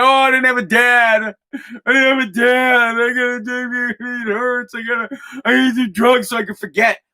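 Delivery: high, whiny mocking voice of a self-pitying addict; mock whining addict. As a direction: sneer at them in a whiny put-on voice. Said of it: "And they're gonna".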